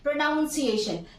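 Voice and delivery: प्रोनाउंसिएशन with "noun" in the middle; the "ow" vowel in प्रोनाउंसिएशन; common mispronunciation. Shown as a demonstration. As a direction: say प्रोनाउंसिएशन as pro-NOUN-see-ay-shun. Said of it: "maximum लोग प्रोनाउंसिएशन बोलते हैं".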